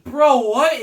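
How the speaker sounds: high, loud incredulous outburst; stunned disbelief. Retold or imitated. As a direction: blurt it out in total disbelief. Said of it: "I'm just like".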